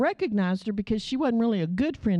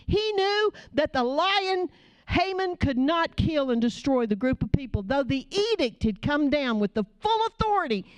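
rising, fervent sermon delivery; impassioned sermon voice; preaching; 5 semitones higher than usual. Acted. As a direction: preach it with conviction to the congregation.

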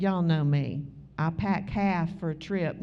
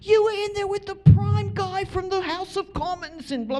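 high, lively British pastor on the phone; 11 semitones higher, 4 dB louder than usual. Retold or imitated. English pastor phoning the preacher, high and excited. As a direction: play the excited English pastor calling her. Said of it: "He's like".

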